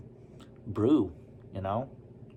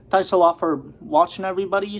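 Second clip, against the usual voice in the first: higher and louder, upbeat thanks; cheery sign-off; 7 semitones higher, 7 dB louder, faster than usual. Real, not acted. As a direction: sign off with a lift in the voice. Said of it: "I said that already".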